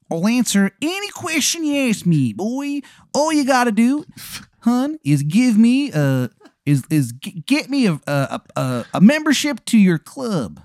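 high-pitched, hammed-up Maryland voice; bad Maryland accent; horrible Maryland accent. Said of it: "the accent is just".

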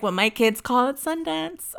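high, slow put-on voice; Lisa impression. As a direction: attempt at Lisa's voice, drawn out. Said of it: "I can't do her voice".

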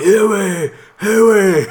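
high, loud mock hangover moan; hungover groan. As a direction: whine it out like a wreck.

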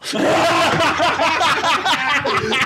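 high, loud burst of laughter; real howling laugh; loud shrieking laugh. Real, not acted. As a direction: crack up at the punchline. Punchline reaction.

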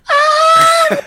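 high, loud pained "Ah!"; hammed-up high-pitched wail; mother's mock wail. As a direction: shriek it like an overwhelmed mom. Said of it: "she's like this".

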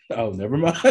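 ordinary delivery

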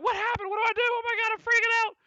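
high, frantic panic; freaking out. Acted. Bard pleading with Ugluk, high and frantic. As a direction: babble in a panic. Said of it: "look at you like".

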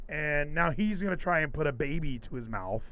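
usual speaking voice

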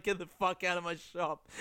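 mock yell while laughing; laughing through a quote. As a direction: giggle through the angry line. Retold pizza man throwing customer out.

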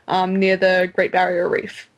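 ordinary talk, nothing marked

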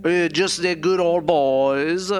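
hammed-up Southern singsong; mock Southern accent. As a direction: stretch it out in a wobbly Southern accent. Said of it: "Our accents are way all over the place".

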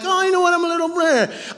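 high, whiny mock confession; mock wishy-washy. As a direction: make fun of vague feelings in a high voice. Mocking in-between feelings.

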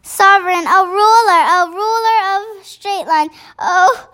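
a big friendly giant calling out, thrilled; excited BFG voice. Acted.

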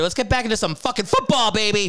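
high-pitched football hype; hyped-up. Real, not acted. Podcast host kicking off the football segment, hyped-up. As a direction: pump up the crowd for football, voice climbing.

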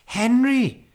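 high, loud cry of a name; shocked cry. A narrator's reading. Wife catching husband with messy bear, shocked cry. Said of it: "cried Missus Brown".

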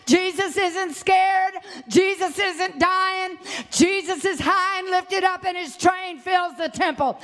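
normal talking voice

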